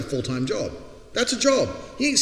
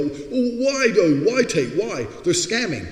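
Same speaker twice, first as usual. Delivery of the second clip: much higher, slow, whining; mock whiny people; 8 semitones higher, 5 dB louder, slower than usual. Critics questioning his gifts, hammed-up whiny. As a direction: whine the question like a baffled critic. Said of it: "they go".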